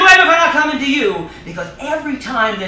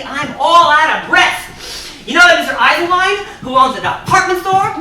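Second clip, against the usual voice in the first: ordinary talk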